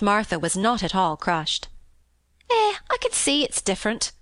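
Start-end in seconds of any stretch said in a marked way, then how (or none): 2.5-4.1 much higher, kindly Yorkshire maid; high, sympathetic maid. Acted. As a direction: soften it for the girl. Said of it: "she answered almost sympathetically".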